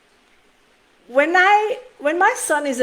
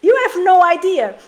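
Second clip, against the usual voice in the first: exasperated retort; 6 semitones higher, 7 dB louder than usual. Retold or imitated. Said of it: "I was like".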